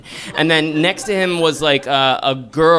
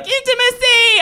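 high panicked cry; panicked yelp; 3 dB louder than usual. Retold or imitated. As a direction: yelp the word in mock panic. Panicked flight from intimacy.

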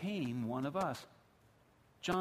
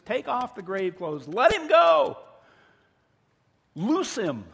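loud orders building to a high yell; commanding shout; 6 semitones higher, 11 dB louder, slower than usual. Acted. Jesus orders the grave clothes off Lazarus, rising shout. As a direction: build to a full shout on "Let him go".

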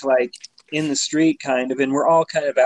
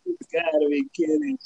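higher, softer stunned mutter; retold disbelief; 10 semitones higher, 4 dB quieter than usual. Seeing his car hit a second time, stunned and higher. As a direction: say it like you can't believe your eyes. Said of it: "and I was just like".

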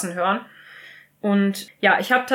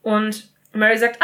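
ordinary talk, nothing marked

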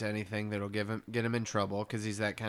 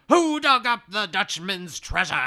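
high, loud booming ghost captain; Flying Dutchman; 10 semitones higher, 6 dB louder than usual. Retold or imitated. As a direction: roar it like an angry ghost pirate. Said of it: "and he's like".

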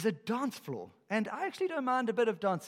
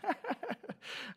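high laugh; 13 semitones higher, 5 dB quieter than usual. Real, not acted. Preacher laughs at own line.

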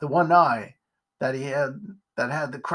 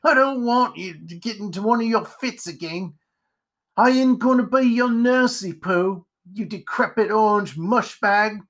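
crotchety, pitched-up scolding; cantankerous character voice, raised pitch; irritated spider character; 6 semitones higher, 3 dB louder than usual. Acted. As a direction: grumble like a fed-up reluctant nursemaid. Irritated spider scolds his companion.

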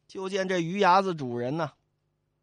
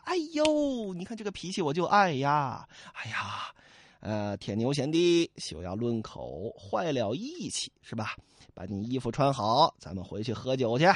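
calm him down fondly; indulgent Song Jiang; 5 semitones lower than usual. Acted. Song Jiang soothes Li Kui. (宋江低声慢慢安抚)

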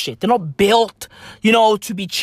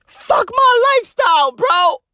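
high, shouted whine of fuck my lifestyle; mock whiny Elon; 12 semitones higher, 3 dB louder than usual. Comic mocking a billionaire's complaint, high whiny shout. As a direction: squeal it like a spoiled billionaire.

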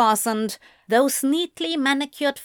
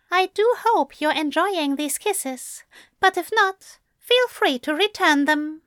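high, airy angel voice; playful, high-voiced politeness; light, teasing; 7 semitones higher than usual. A narrator's reading. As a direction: toss off the flirtation lightly, pitched up. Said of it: "Aziraphale continued lightly".

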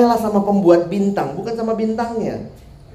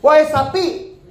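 higher-pitched mock yell; imitated shout; 6 semitones higher, slower than usual. Student suddenly yelling in the food queue, imitated shout. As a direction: call out like a heckler in a queue. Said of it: "tiba-tiba ada yang teriak".

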